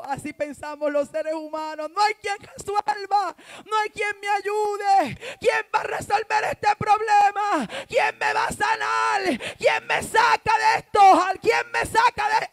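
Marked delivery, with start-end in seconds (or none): 1.9-12.5 high, loud cries for help; desperate shouting. Acted. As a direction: shout out in hammed-up despair. Voicing humans' desperate cries. (gritos desesperados, agudos y exagerados)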